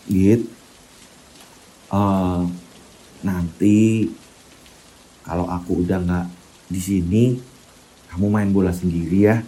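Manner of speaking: suara Bayu lirih. Acted. Bayu's voice in the story. (suara anak lirih dan pelan, seperti berpamitan)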